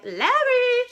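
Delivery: very high, loud delighted cry of a name; sudden loud call. A narrator's reading. Star Sandra spotting an old friend, calling out loudly. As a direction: fling out the name across the street. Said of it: "she called out suddenly".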